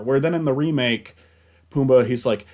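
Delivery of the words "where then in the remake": ordinary delivery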